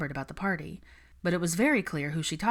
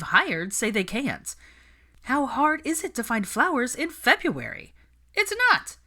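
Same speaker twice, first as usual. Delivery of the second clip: from annoyed to high, loud outburst; stressed bride losing patience, rising to a shout; exasperated rant building; 8 semitones higher, 5 dB louder than usual. Acted. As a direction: climb to a shrieking peak.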